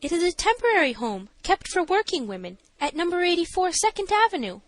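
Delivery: high-pitched matron's testimony; high character voice answering the judge; nervous matron. A narrator's reading. Voices Missus Stannard.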